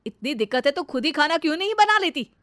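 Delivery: loud, cutting telling-off; husband's angry rebuke. Acted. Husband scolds wife for shouting at his mother, angry. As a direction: shut her up and take her to task.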